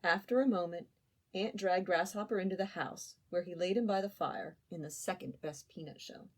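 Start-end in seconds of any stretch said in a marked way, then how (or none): none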